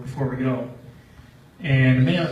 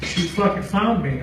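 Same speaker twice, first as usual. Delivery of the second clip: normal talking voice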